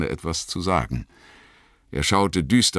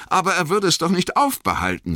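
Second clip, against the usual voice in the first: high-pitched hobbit voice; young hobbit, protesting; 4 dB louder, faster than usual. A narrator's reading. Merry objects about Frodo and the Ring, high-pitched.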